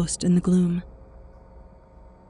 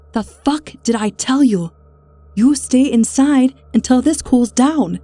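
loud, higher angry tirade; furious scolding; 5 semitones higher, 6 dB louder than usual. A narrator's reading. Woman scolding the man who went outside, furious and loud. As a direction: lay into him like a furious grandmother.

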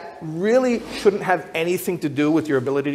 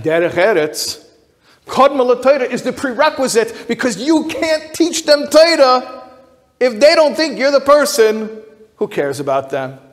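much higher and louder, preaching; impassioned climax; 10 semitones higher, 8 dB louder than usual. Real, not acted. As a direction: build to a fervent peak on the teaching. Teacher's sermon reaches its climax.

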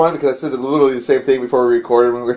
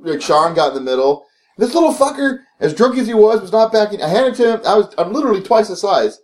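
ordinary talk

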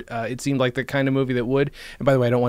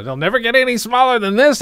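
higher, hammed-up smug prediction; mock movie line; 10 semitones higher, 4 dB louder than usual. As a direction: play the cocky guy sure of himself. Mocking a dated movie joke.

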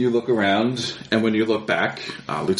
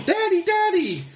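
put-on much higher child's voice calling out; little girl calling. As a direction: call for daddy like a small girl. Daughter calling from her room.

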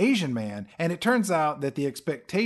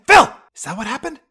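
demanding Zach; 10 semitones higher, 12 dB louder, faster than usual. Retold or imitated.

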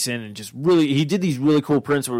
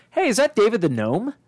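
put-on high, bright-pitched recognition, like spotting an old friend; retold delighted surprise; 10 semitones higher than usual. Fan recognizing a childhood cartoon print at a booth, delighted surprise. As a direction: replay the moment you recognized a childhood cartoon.

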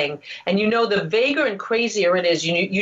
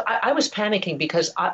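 usual speaking voice

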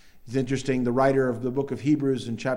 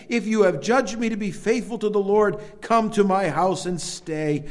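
pleading invitation; 6 semitones higher, 4 dB louder than usual. Retold or imitated. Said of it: "she begged us, saying".

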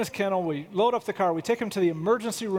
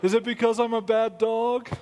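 a touch higher, mopey dog; lightly voiced guilty dog; dog's imagined voice; 3 semitones higher than usual. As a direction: plead like a sorry pup. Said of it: "He's like".

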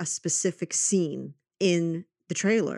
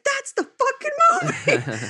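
very high, laughing excited shout; laughing yell of triumph; 7 dB louder, slower than usual. Retold or imitated. Finally finding the lost film.

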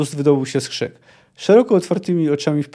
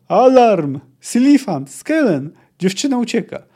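loud, high warning shouts; urgent high alarm call; yelled alarm; 6 semitones higher, 5 dB louder than usual. A narrator's reading. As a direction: shout names to raise the camp. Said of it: "wrzasnęła nagle".

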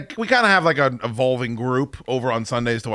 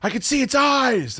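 much higher mock panic; panicked wife; 13 semitones higher than usual. Wife telling husband she sees the snake, high mock panic. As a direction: imitate her frantic insistence. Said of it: "my wife's like".

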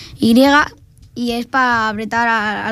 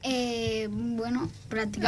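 far from mic; 11 dB quieter, slower than usual. Real, not acted. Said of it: "Acércate al micro, [name], que te vas para atrás".

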